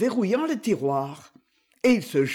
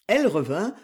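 ordinary delivery

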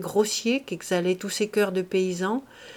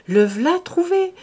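higher, slow, drawn-out relish; savoured delight; 9 semitones higher, 7 dB louder, slower than usual. A narrator's reading. Farmer repeats to himself, higher and savouring. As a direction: stretch it out with glee.

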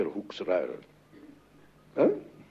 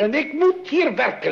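angry outburst; 12 semitones higher, 5 dB louder than usual. Acted. Father scolds daughter typing in living room.

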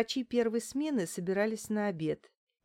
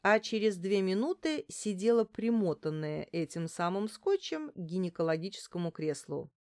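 plain reading voice

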